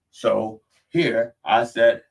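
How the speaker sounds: usual speaking voice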